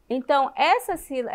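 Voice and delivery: ordinary talk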